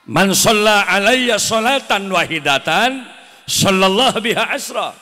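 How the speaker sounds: hadith called out slow and loud; loud slow recitation. Acted. Preacher reciting hadith to the crowd, slow and loud.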